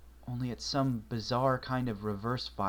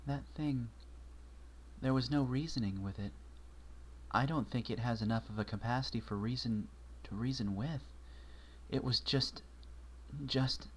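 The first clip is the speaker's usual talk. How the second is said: small, softer voice of a frightened mare; 3 semitones higher, 6 dB quieter than usual. Acted. Twilight admitting to Marshall she found no words, small voice. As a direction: let her voice shrink as the words run out. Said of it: "spoke in a small voice".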